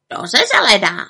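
croaking raven. A narrator's reading. Raven agrees the aunt will turn green, croaking. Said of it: "krákal Abraxas".

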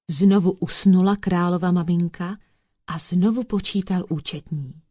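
usual speaking voice

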